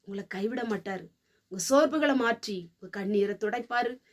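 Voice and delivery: ordinary talk, nothing marked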